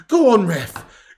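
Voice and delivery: slow, loud, begging call to the ref; pleading with the referee. Real, not acted. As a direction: drag it out, urging the ref.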